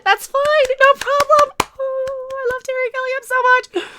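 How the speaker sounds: mock sarcastic critic. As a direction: sneer it in a silly high voice. Mocking critics in silly voice.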